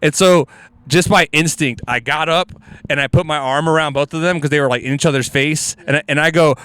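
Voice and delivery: usual speaking voice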